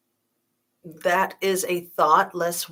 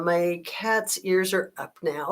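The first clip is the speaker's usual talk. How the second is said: ordinary talk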